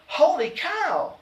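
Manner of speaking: mock scream, pitched high and stretched; screamed exclamation. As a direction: cry it out in sudden uproar. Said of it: "screamed Jane".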